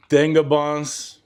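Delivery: "Tanga bombs" drawn out slowly, leaning on TAN; careful tanga. Shown as a demonstration. Cooking vlogger sounding out the dish name slowly. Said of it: "I wanna put some emphasis on that".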